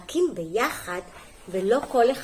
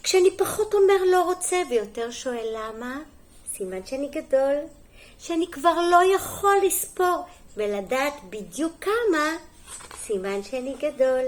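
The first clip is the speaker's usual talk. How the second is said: lively, sing-song picture-book reading; story reading to children; 5 semitones higher, 3 dB louder than usual. Acted. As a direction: read it clearly to small children, lifting the key words. (הקראת סיפור לילדים)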